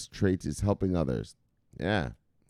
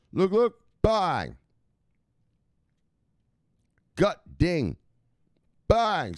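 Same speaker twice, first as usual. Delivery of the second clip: hammed-up sign-off; 9 semitones higher, 3 dB louder, much slower than usual. Catchphrase sign-off.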